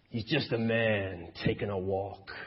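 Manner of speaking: an American vice president, lightly imitated; American vice president accent. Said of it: "It's my American vice president accent".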